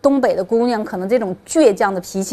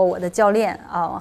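ordinary talk, nothing marked

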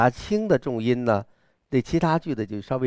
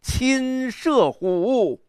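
very slow, higher on 亲射虎; wild proud recital; 8 semitones higher, much slower than usual. Shown as a demonstration. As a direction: soar up proudly then settle. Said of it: "你看这个狂". (狂放地高声慢读)

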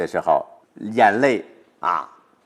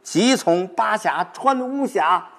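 eager recitation; 9 semitones higher, slower than usual. Shown as a demonstration. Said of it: "然后你想象的你还乡的路线".

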